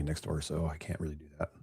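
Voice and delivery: hushed and fast; quiet. Real, not acted. Baby next door.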